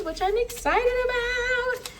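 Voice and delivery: high, drawn-out sing-song excitement; excited. Real, not acted. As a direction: sing it out with glee. Sings out her excitement about tomatoes.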